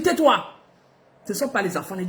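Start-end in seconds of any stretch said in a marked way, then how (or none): 0.0-0.4 mock stern hush; sharp order. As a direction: cut her off hard. She orders her to stop crying.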